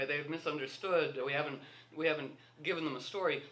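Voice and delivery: ordinary talk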